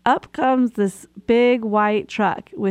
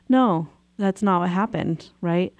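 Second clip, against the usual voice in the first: normal talking voice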